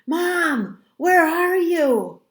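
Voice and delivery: high, loud, drawn-out call; anxious yell for a parent; calling out frantically. A narrator's reading. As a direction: shout it through an empty house. Frantically searching house for parents.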